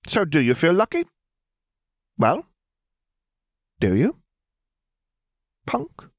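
a lifted, amused female voice; Lily's voice. A narrator's reading. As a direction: correct the charge with dry poise.

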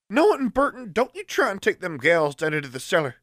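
high call from nearby room; calling from another room. Acted. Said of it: "called Prudence from some room nearby".

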